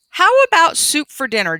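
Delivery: lightly voiced boy, eager question; [name] asking. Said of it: "[name] asks".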